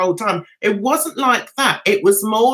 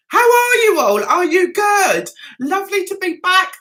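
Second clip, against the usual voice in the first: cheery hello; 8 semitones higher, 3 dB louder than usual. Real, not acted. Greeting the viewers.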